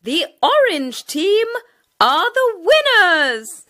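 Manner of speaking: high, bright smiling announcement; proudly name the winners; teacher announcing the winners, smiling. A narrator's reading. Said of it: "smiled the teacher".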